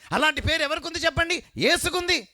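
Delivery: rising to a high, loud preaching cry; preaching build-up. Acted. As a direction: build the point up to a shout. Preacher calls on congregation. (పెరుగుతున్న ఉత్సాహంతో ప్రసంగం)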